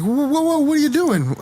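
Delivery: high-pitched mock alarm; alarmed retelling. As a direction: act out startled protest, pitched high. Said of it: "and I was like".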